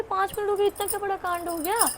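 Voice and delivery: high, mocking take on mom's annoyed question; mock exasperated mom. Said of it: "मैं बोली".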